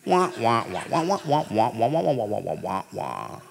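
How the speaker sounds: muffled trombone wah wah; wah-wah like the Peanuts teacher; Charlie Brown teacher. Retold or imitated. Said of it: "the preacher sounds like the teacher in Charlie Brown".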